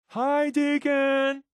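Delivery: high, slow singsong hello; hammed-up chorus welcome; mock group greeting. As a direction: chime it like a support group all at once.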